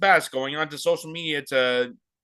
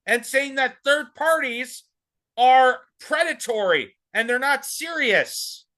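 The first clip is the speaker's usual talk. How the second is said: angry rant; 6 semitones higher than usual. Real, not acted. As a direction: tear into her, raised voice. Heated rant at AOC.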